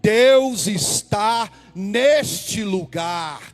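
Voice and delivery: loud, drawn-out preaching cry; shouted preaching. Acted. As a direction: lift the church with it. (pregação gritada e arrastada)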